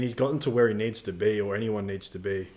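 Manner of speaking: ordinary delivery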